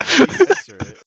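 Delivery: loud squeaky high demand; high squeal. Pretend. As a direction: squeal the question in mock outrage.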